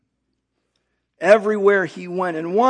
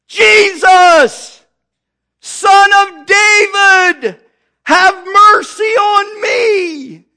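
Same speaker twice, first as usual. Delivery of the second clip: desperate shouted plea, higher and louder; crying out; 9 semitones higher, 9 dB louder, slower than usual. Acted. Blind Bartimaeus shouting to Jesus from the roadside, desperate loud plea. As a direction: cry out for mercy as the blind beggar. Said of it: "he began to cry out".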